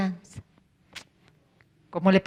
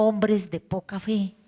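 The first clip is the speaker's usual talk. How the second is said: "Hombres de poca fe" lower, softer and slow, stress on Hombres; quiet rebuke; 3 semitones lower, 7 dB quieter, slower than usual. Acted. Jesus quietly reproaches the disciples, "Hombres de poca fe" soft and slow. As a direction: say "Hombres de poca fe" in a slow low disappointed murmur. Same rebuke, retold softly.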